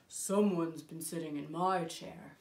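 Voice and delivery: low, deliberate big bear voice; Papa Bear. Acted. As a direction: speak from the bottom of your range as a big bear. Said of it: "Papa Bear saw that someone".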